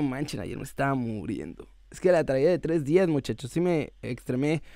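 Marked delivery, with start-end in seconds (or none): 0.0-1.7 low, drained, barely alive; hungover. Real, not acted. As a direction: hungover and worn out. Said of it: "dice que ando crudo". (crudo, sin energía)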